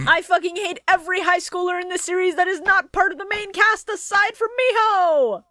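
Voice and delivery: high-pitched ranting yell; hammed-up rant. Exaggerated rant about high schoolers.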